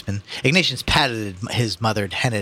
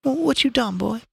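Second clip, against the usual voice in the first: high, trembling mother's voice. A narrator's reading. Mother to her son, trembling. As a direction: call his name in a high, trembling voice. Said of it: "said in a trembling voice".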